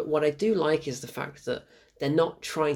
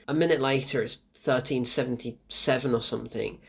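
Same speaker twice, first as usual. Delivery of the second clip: ordinary talk, nothing marked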